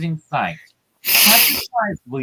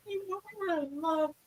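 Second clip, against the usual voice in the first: much higher, much softer lovesick plea; 13 semitones higher, 13 dB quieter than usual. Acted. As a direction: coo it like a lovestruck girl. High pleading voice.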